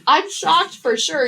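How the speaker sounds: mock stunned boyfriend; hero voice. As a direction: lightly voice the shocked boyfriend. Voicing hero in retelling.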